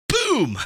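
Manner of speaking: high, loud mock explosion; gunshot boom. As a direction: burst it out like a gunshot. Imitating the sudden gunshot.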